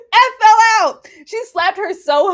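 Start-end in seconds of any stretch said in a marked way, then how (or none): 0.1-1.1 "I fell out." much louder and higher; louder laughing exclaim. Real, not acted. Streamer reliving the painting scene, much louder and higher. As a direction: shout it out, cracking up.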